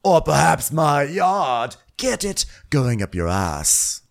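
high, hammed-up bossy voice; mock Lisa pep talk. Lisa coaching the team on design, high hammed-up bossy voice. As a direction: coach them like a pushy boss. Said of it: "So Lisa's like".

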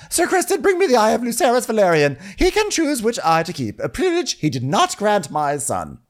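high, loud, frenzied royal command; hammed-up queen demanding revenge; mock unhinged queen. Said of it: "She was really unhinged".

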